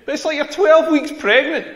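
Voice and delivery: can't believe what he's reading; incredulous. Real, not acted.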